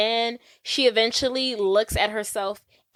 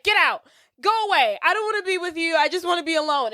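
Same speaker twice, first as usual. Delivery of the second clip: upset quote; 6 semitones higher, much faster than usual. Retold or imitated. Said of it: "And then she's like".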